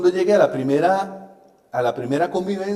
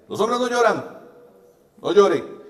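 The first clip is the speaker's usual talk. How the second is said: a hint of a stern, booming father laying down the law; mild mock order from a strict father; authoritarian father voice; 4 semitones higher, 4 dB louder than usual. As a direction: lightly mimic the bossy old-school dad. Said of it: "aquella vocesota así autoritaria".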